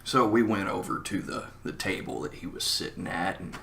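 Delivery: ordinary delivery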